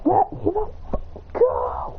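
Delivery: acted struggling cry. Stuck in the chains, strained struggling cry. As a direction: drag the words out as you struggle.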